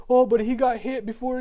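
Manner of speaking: sarcastic imitation of defenders of the quarterback; mocking excuse. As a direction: parrot the excuse-makers with a sneer. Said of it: "for everyone to be like".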